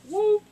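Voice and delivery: short, high, loud woo; quick squealed falling noise; falling whoop. Retold or imitated. Narrator miming the fall before impact, high whoop.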